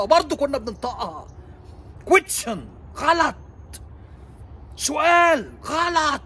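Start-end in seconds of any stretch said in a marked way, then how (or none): 2.0-6.2 shout. Acted. Ranting about the wrong pronunciation.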